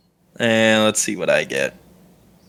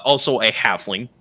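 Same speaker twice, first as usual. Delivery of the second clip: ordinary delivery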